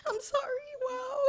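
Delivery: crying. Real, not acted. Overwhelmed winner sobbing to the crowd in real tears. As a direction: voice breaks into a high sob. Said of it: "I'm crying".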